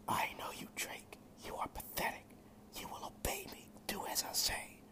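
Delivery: soft, breathless whispering demon; demon king whisper. A narrator's reading. Demon king whispering inside Drake's head, menacing whisper. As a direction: hiss it like a voice inside his head.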